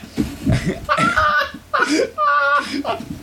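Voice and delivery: high squealing laugh near tears; helpless laugh. Real, not acted. Laughing at his own story with his friend, high and teary. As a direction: break into crying laughter. Cracks up after the shout.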